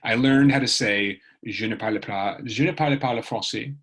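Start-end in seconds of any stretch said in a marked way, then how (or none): 1.4-3.8 Je ne parle pas le français in careful schoolbook French; best French. Shown as a demonstration. Showing viewers her only French phrase, in schoolbook French. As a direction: recite the one French phrase she knows. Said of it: "That's my best French".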